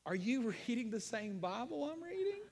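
amused disbelief, pitch climbing to the end; incredulous. Real, not acted. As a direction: ask it as an astonished challenge. Mock challenge to people denying Scripture.

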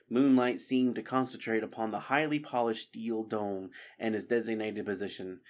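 ordinary delivery

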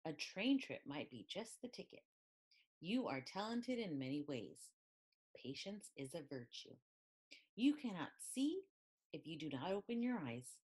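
ordinary delivery

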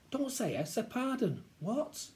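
high-pitched motherly telling-off; correcting mum. Acted. Mum corrects child's manners, scolding.

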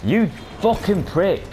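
high, drawn-out furious yell at the enemy; furious slow yell. Real, not acted. Gamer yelling at enemy player, furious high yell. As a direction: spit the insult out slowly, enraged. Enemy dives him in game.

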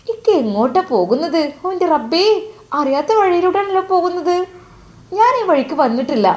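put-on a young wife asking where the road went, pitched high; worried young wife. Wife Nusaiba asking her husband about the wrong road, high and worried.

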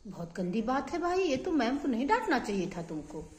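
soft, low consoling voice; soothing sympathy. Real, not acted. Consoling a scolded, upset child. (धीमे, दिलासा देते हुए)

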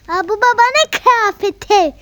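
high, loud surprised gasp. Acted. Şaşkın buluş. (şaşkınlıkla yüksek sesle)

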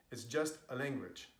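ordinary delivery

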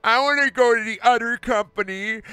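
high-pitched childish whine, hammed up; mock a wrestler complaining like a toddler. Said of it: "doing it in that voice".

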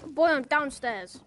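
slow loud call downstairs; yelling across the room. Pretend. Calling from another room.

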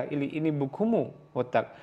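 usual speaking voice

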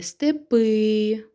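степи with a long, drawn-out ПИ; stress on final И. Shown as a demonstration. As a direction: lean on the last syllable of степи. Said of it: "наголос куди падає?".

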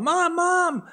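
put-on high, childlike call for mom; a little boy shouting for his mother, high-pitched. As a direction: call upstairs like a little kid. Boy calling for mom in story.

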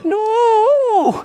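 very high, long-held no; despairing wail. Acted. Despair at losing.

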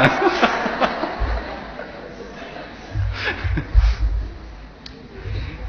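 long fading unvoiced chuckle; long breathy laugh. Real, not acted. Long laugh after punchline. (长长的轻笑)

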